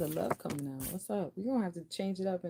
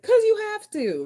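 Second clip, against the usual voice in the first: much higher, louder curt retort; snapping at a child; 12 dB louder than usual. Retold or imitated. A parent answering a child's question, loud and impatient. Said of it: "in a manner it's like".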